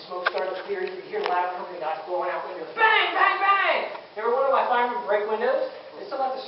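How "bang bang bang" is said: imitated bangs. Windows being broken.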